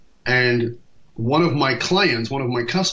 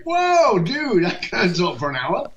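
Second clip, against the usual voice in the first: a high yelp on "Whoa" and a startled protest; shocked protest; 7 semitones higher, slower than usual. Retold or imitated. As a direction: throw up a sudden high Whoa in disbelief, then object. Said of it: "I'm like".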